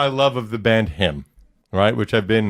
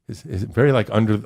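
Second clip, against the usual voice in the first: ordinary talk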